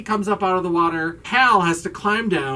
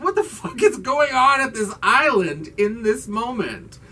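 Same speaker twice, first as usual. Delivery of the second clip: exasperated disbelief; 7 semitones higher than usual. Retold or imitated. Teller's baffled reaction to show.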